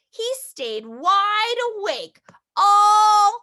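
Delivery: very slow, drawn out trailing; the chicken's story drifting off; slow fade into sleep. Acted. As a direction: let it slow down to nothing. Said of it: "trails off as papa snores".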